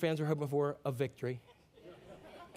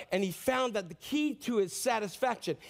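usual speaking voice

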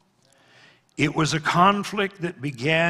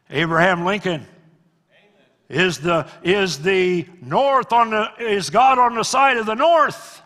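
pressing question; 6 semitones higher, 10 dB louder than usual. Retold or imitated. Asker quoted pressing Lincoln.

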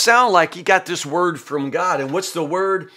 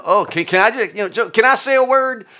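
higher, pushy mock-polite friend; mock eager Eliphaz; 7 semitones higher than usual. Eliphaz begging Job to let him speak, mock eager. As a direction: butt in with fake politeness. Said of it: "but now, you know".

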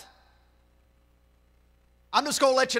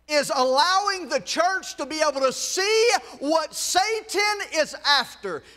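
preaching; 4 semitones higher, slower than usual. Acted. Preacher addressing congregation.

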